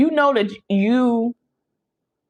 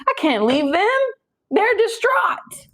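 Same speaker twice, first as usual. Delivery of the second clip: high, drawn-out whimper, hammed-up; mock distraught whine; 13 semitones higher, slower than usual. Man torn over leaving his partner, hammed-up high whine. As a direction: stretch it out like a pitiful crier. Crying man can't leave.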